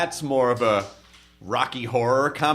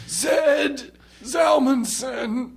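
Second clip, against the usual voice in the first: strained, higher shout of the last name; hoarse mock shout; 11 semitones higher, much slower than usual. Comic reading the whole phone book, hoarse mock shout. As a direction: yell it as if your voice is shot. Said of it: "You're just hoarse when you get to the Z's".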